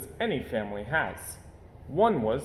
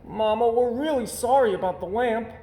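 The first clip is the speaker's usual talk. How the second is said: cub voice; 10 semitones higher, 4 dB louder than usual. Acted. Cub apologizes to Mama.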